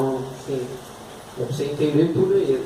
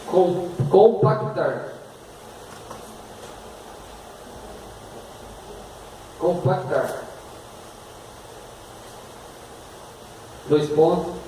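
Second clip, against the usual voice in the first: slow dictation with pauses; dictating; 2 semitones higher, 5 dB quieter, slower than usual. Shown as a demonstration. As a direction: dictate word by word. Dictating definition.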